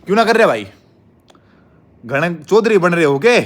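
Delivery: loud, high challenge in play-acted rage; mock anger. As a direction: shout it as a pretend threat. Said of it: "गुस्सा ही दिखाता हूँ तुम्हें".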